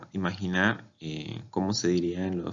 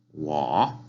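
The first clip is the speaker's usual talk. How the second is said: "Wo" from low upward; third tone; 5 semitones lower, 4 dB louder than usual. Shown as a demonstration. Teacher explaining the accent mark, low-rising tone. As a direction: sink low on "Wo" and swing up. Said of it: "Es un tono de abajo hacia arriba".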